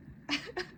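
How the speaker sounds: a brief, quiet, held-back laugh; a small nervous laugh that fights off tears; short soft laugh. Real, not acted. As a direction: laugh softly to keep from crying. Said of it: "I'm laughing because I don't wanna cry".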